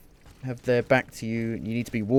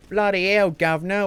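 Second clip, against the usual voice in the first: higher, louder hammed-up Cockney exclamation; mock Cockney; 6 semitones higher, 3 dB louder than usual. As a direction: blurt it like a London cabbie. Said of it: "Why do we speak like this in France?".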